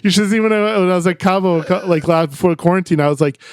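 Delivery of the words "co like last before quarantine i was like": normal talking voice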